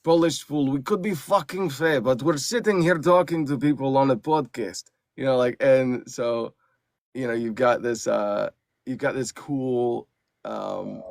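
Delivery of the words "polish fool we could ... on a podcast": higher, singsong feral beast with Slavic accent; Vashki. Shown as a demonstration. Feral alter ego griping about the podcast, high accented voice. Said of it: "and Vashki's like".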